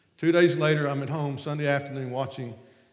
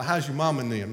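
ordinary talk

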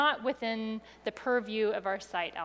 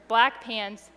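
usual speaking voice

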